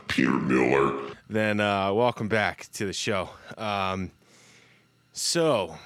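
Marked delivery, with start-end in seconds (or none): 0.1-1.1 low, booming name drop; deep announcer voice. Pretend.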